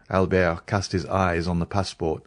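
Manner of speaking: ordinary delivery, nothing marked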